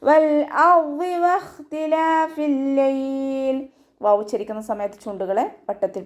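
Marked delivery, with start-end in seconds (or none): 0.1-3.6 slow, high melodic tajweed chant; chanted Quranic verse, drawn out; Quran recitation. Shown as a demonstration. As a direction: stretch each syllable in a ringing recitation.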